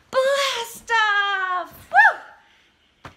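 loud, high blast-off shout; big shout. Acted. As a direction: yell it out as the rocket launches. Rocket launch.

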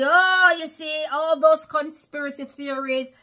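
loud, high sneering mimicry of the crowd; mocking quote. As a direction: scoff at the doubters in their voice. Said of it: "and everybody".

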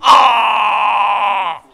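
very high, very loud, drawn-out yelling; real frantic hype; screaming cheer. Real, not acted. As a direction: scream him on at full force. Screaming for the batter.

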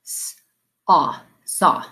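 "S-" then "aw" then "saw" blended; segmenting demo; sound by sound. Shown as a demonstration. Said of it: "Say "saw".

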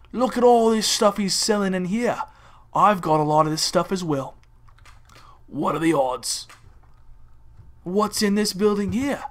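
mock Australian wildlife-host voice; Steve Irwin impression. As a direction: narrate a safari, punching each crikey. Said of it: "I feel like the Steve Irwin of buildings".

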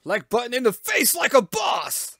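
slow, high bold yell; slow hammy heroic shout; 1 hammed-up heroic shout. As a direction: boast it like an action hero. Hammed-up hero line.

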